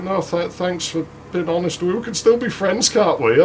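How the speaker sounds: put-on high, softened voice of a let-down girl; imitated brave girl. Turned-down girl asking to stay friends, imitated hopeful voice. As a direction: pitch it up, meek and hopeful.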